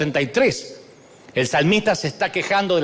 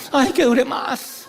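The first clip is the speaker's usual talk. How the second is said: adorador extasiado; 4 semitones higher, slower than usual. Retold or imitated.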